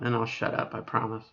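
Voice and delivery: ordinary talk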